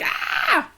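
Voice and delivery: high breathless whispered yelp; a tiny panicked squeak, no voice; mock panic squeal. Overwhelmed by fast channel growth, high breathless squeak. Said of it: "I'm like".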